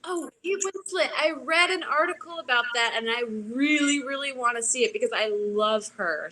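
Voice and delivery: high, gushing delight; real burst of high-pitched enthusiasm; excited fan. Real, not acted. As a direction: gush about an actress you adore. Fan reacting to favorite actress.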